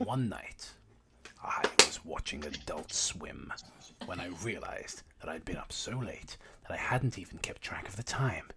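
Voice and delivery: hushed creepy storytelling; hushed whisper. Acted.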